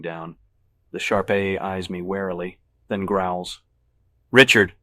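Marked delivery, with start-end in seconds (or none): 4.3-4.7 loud, higher-pitched reprimand; sharp scolding. Acted. Dog owner to his growling pet, sharp scolding. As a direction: call out sharply to stop the dog.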